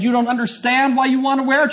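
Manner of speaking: high-pitched retold challenge, rising at the end; incredulous challenge. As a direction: imitate mounting disbelief. Retold exchange, questioner incredulous.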